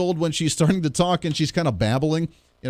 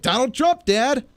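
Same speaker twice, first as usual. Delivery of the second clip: put-on child voice; 8 semitones higher, slower than usual. Daughter voiced again.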